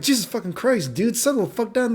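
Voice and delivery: high-pitched mock exasperated plea; retold exasperation. Fending off the girls, retold.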